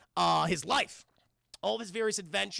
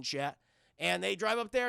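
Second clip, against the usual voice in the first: ordinary delivery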